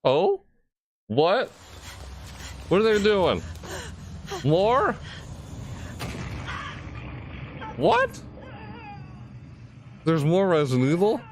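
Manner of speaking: rising squeals of disbelief; high, stunned excitement; surprised. Real, not acted. Resident Evil chapter reveal.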